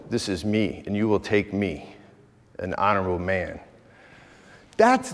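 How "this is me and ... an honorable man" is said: dignified proud man. Retold or imitated. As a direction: stand tall and speak with honor. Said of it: "understand me to be honorable".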